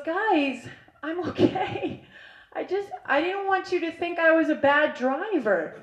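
small, high, soft voice; meek friend. Retold or imitated. Angel's retold meek confession.

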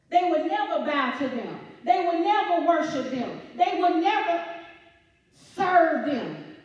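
preacher building to a climax; preaching build. Acted.

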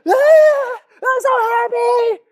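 put-on high, slow squealing child voice; happy kid. Voicing a happy child in the photo, high and slow. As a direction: squeal with pure joy like a little kid. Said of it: "that kid's like".

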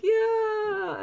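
high, loud gleeful yell; a child leaping off a cliff, yelling with joy; excited yell. Pretend. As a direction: whoop it off the cliff edge. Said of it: "and you're yelling".

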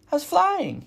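high, loud little squeak; excited baby bird squeaking; squeaky gosling voice. A narrator's reading. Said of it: "he squeaked".

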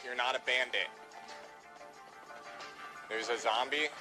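ordinary talk

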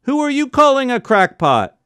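high offended outburst; indignant. A narrator's reading. Father offended by the colonel, indignant. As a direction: bristle at the insult.

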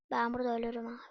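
breathless whispered despair. Real, not acted. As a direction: whisper it as if you might die here. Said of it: "garip sesler çıkardıktan sonra".

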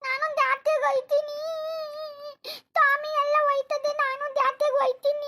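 put-on high, pleading little-girl voice; childish daughter. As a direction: beg like an excited child to go to the fair. Mother actor voices childish daughter.

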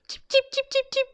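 very high tiny cheeps; chick cheeping. Pretend. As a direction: peep like a baby chick. Chick sound for children.

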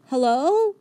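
high hopeful hello, rising; high dog voice. A narrator's reading. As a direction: Rosie greets the bowl dog again, high and rising. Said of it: "says Rosie".

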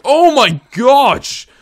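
slow, loud, high startled gasp; startled cry. Real, not acted. Player startled mid-sentence, loud startled cry. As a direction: jump at the fright. Sudden scare.

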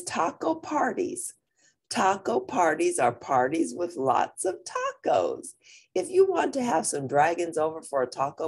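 lively storybook reading; reading to children. A narrator's reading. Teacher reading a picture book to the class, lively. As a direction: read it out to the kids, bright and clear.

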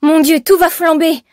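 high, loud panic; panicked cry. A narrator's reading. Seamstress seeing tall flames, panicked cry. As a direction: cry out high in sudden alarm. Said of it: "s'affola Odile".

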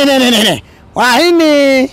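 ordinary delivery

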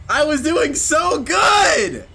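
frustrated yell. Real, not acted. Gamer after dying in the game, frustrated yell. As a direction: drag it out in frustration.

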